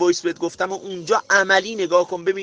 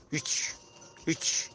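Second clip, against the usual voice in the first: mumbled reply; 3 semitones lower, 12 dB quieter, slower than usual. Retold or imitated. Listless reply in acted dialogue.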